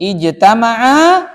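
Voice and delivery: "Ijtama'a" stretched, rising at the end; slow "Ijtama'a" left hanging upward for the class; slow model reading. Shown as a demonstration. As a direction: prompt with a drawn-out "Ijtama'a".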